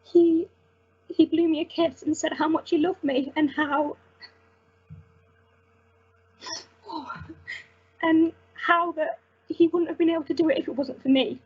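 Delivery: real tears, pitch jumping up as the voice cracks; voice breaking. Real, not acted. Nurse recalling patient thanking her, voice breaking with tears. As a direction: push through the words while crying. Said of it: "I was absolutely beside myself".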